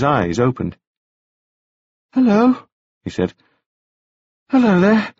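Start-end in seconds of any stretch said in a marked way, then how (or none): 2.1-2.6 put-on much higher, bright boyish voice; high bright young gentleman. A narrator's reading. Wounded Staines greeting Taufari, high and bright. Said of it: "speaking in a voice that was high and very bright". 4.5-5.1 put-on much higher, bright boyish voice; high bright young gentleman. A narrator's reading. Wounded Staines greeting Taufari, high and bright. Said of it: "speaking in a voice that was high and very bright".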